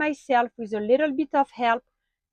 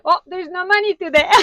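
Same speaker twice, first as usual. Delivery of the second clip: higher, louder playful exclamation; mock surprise; 7 semitones higher, 6 dB louder, faster than usual. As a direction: pretend to be caught off guard, about to laugh. Jokey mock discovery of missing money.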